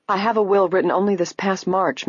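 ordinary delivery, nothing marked

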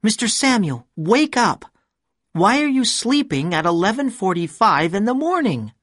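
high, sharp woman's voice, annoyed; angry. A narrator's reading. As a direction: scold him for sleeping late. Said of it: "she was angry with me".

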